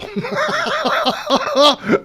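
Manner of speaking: high, loud laughing burst; burst of high laughter. Real, not acted. Laughs at own story.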